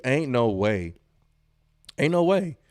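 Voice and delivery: ordinary delivery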